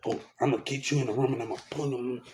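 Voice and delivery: low, soft mock threat; menacing quote. Man imitates those who threatened him, in a hushed low menace. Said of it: "They one day say".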